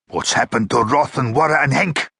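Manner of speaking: a sharp, demanding question; a guard snapping at the prisoner about missing crewmates; snapped. A narrator's reading. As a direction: bite the names out impatiently. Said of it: "snapped Iraq".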